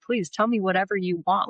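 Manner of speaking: ordinary talk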